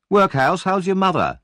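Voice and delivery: put-on high, loud jeering boy; taunting bully. Noah jeers at Oliver about his mother, high and taunting. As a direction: sneer it at him like a bully.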